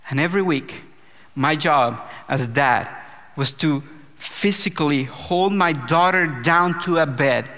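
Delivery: usual speaking voice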